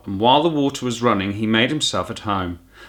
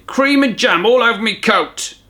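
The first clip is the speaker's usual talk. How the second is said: higher, louder bitter complaint in a rough cabbie voice; 12 semitones higher, 5 dB louder than usual. Acted. Taxi driver moans about his coat, bitter and gruff. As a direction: grumble it out, fed up and sticky. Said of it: "he said bitterly".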